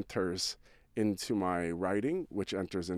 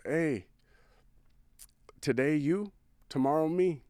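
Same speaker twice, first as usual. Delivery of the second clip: higher, louder, lightly imitated Spanish accent; imitated Spanish accent, raised and deliberate; 7 semitones higher, 5 dB louder than usual. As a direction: deliver it earnestly with a hint of a Spanish accent. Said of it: "Spanish accent, I hear".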